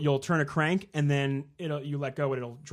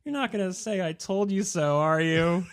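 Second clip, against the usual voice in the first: higher-pitched child imitation; mock cheeky kid asking Dad; kid's taunt; 5 semitones higher than usual. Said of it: "the kid goes".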